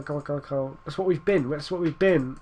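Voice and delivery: ordinary talk, nothing marked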